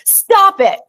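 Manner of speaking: high, loud snapped stop it; curt command. Retold or imitated. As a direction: bark it out as the doctor's whole cure. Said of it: "he goes".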